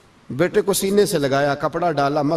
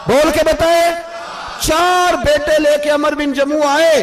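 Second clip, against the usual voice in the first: rousing preaching; 8 semitones higher, 4 dB louder than usual. Acted. Preacher calling on the congregation to answer aloud, rousing.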